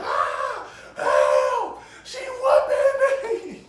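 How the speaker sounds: loud, strained, dragged-out yell; screaming for help. Retold or imitated. A kid mid-whooping calling his brothers, loud wailing. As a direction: wail it out for help, hammed up. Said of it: "how I'm screaming for them".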